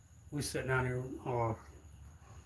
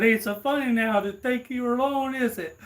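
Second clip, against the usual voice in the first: high, loud teasing gloat; mocking taunt; 9 semitones higher, 7 dB louder than usual. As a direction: rub it in with a crowing sing-song. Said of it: "I said".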